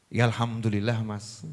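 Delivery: low, soft, understated reply; meek wife. Acted. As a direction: answer your husband meekly, sinking low and quiet. Acts wife's meek, evasive answer.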